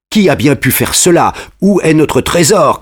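lightly voiced boys crying out; mild shout. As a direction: call out in mock alarm, not at full volume. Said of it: "crient les Longevernes".

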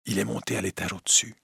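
a quick, hushed warning; low voice. Acted. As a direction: murmur it fast so no one on the stairs hears. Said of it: "dit à voix basse".

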